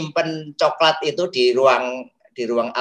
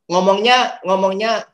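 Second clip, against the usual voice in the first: emphatic; 6 semitones higher, 6 dB louder than usual. Shown as a demonstration. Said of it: "ngomongnya pelan".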